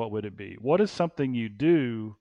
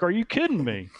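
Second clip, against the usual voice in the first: incredulous; 7 semitones higher than usual. Retold or imitated. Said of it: "I'm like".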